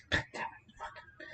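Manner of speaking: unvoiced whisper of frustration; whispered curse. Real, not acted. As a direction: swear under your breath. Cursing at dropped part.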